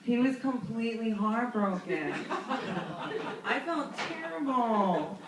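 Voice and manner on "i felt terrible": normal talking voice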